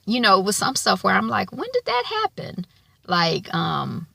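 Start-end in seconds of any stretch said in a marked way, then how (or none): 1.5-2.7 high, baffled question; puzzled. Retold or imitated. Viewer watching out of order asks, mock baffled. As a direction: act lost in the plot.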